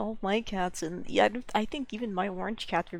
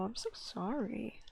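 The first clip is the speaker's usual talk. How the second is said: slow, whispered apology to the cat; hushed, slow sorry; soft whisper; 6 dB quieter, slower than usual. Real, not acted. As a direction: whisper it slowly, gently sorry.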